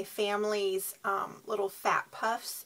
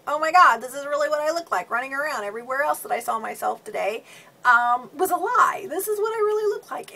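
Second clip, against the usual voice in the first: loud, high, rushed mirror panic; panicked inner voice; 5 semitones higher, 12 dB louder, faster than usual. Retold or imitated. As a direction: blurt it out in horror at your reflection. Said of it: "we somehow tell ourselves".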